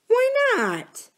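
put-on high, light little-girl voice, puzzled; a small girl asking back sharply; young girl Mary. Girl Mary asking Uncle Tom why not, high and puzzled.

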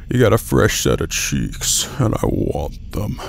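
very low, soft creep voice; deep, lecherous put-on voice; creepy leering. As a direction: lust after it in a slow, deep murmur. Leering bit about onscreen cheeks.

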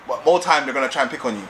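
ordinary delivery

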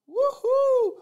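very high, loud celebratory whoop; excited whoop. Real, not acted. Cheering after a cha-ching.

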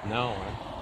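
ordinary delivery

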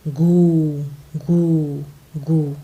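a narrator mimicking an owl's hoots. A narrator's reading. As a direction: hoot it like an owl in the night. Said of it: "ઘુવડ હજી સાડું બોલ્યા કરતું હતું".

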